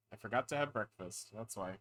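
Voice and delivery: ordinary delivery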